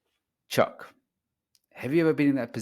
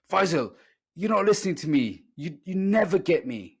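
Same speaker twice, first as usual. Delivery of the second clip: imitating his upset wife, pitched higher and louder; mock the wife's hurt complaint, raised and pressing; 6 semitones higher, 4 dB louder than usual. Ex-wife telling Faisal he never listens, in a higher, louder upset voice.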